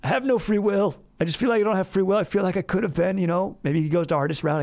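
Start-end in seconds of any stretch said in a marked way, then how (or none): 0.0-3.6 put-on high-pitched self-pitying drunk; hammed-up forlorn husband, higher voice; mock sad. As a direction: confess like a sad drinking buddy. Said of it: "then it's gonna get sad".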